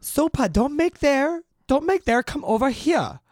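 high, loud voice for a little dog; scolding a pet. Pretend. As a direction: call the puppy away, high and loud.

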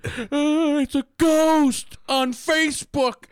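very high, drawn-out fake panic; mock terror. Mocking a horror film victim on Facebook, fake terrified. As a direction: squeal it in hammed-up fright.

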